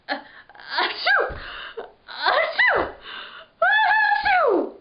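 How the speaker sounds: big high sneezes building up; sneezing fit. Acted. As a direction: let the sneezes explode one after another. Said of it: "too busy sneezing".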